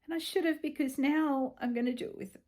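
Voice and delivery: ordinary talk, nothing marked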